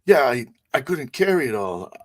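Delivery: put-on high, halting old man's voice; frightened old man. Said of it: "He's like".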